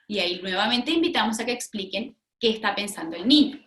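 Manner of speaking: ordinary delivery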